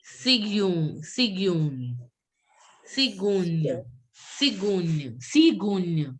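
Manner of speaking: cigogne modelled repeatedly with falling pitch; ny sound closing cigogne; French cigogne. Shown as a demonstration. Teacher naming the stork, cigogne said for students to copy. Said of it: "And it's called".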